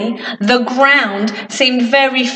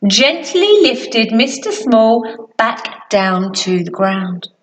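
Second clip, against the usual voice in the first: ordinary delivery, nothing marked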